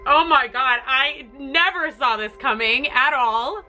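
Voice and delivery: ordinary talk, nothing marked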